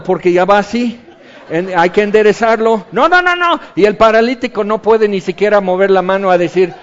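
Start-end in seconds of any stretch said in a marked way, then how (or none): 2.9-3.6 high squeaky no no no no; panicked high no. Retold or imitated. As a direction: yelp in alarm as he slips. Friends panicking while lowering. (un "no, no, no" agudo de pánico)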